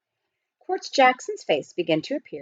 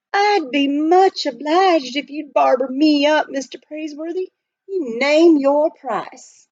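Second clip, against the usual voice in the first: high, loud, folksy prospector; old miner voice; 8 semitones higher, 3 dB louder, slower than usual. Acted. Miner explaining to the butler, folksy and loud. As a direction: play the chatty gold miner.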